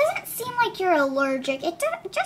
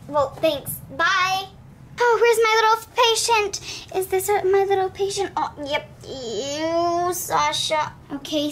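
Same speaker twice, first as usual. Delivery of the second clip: ordinary talk, nothing marked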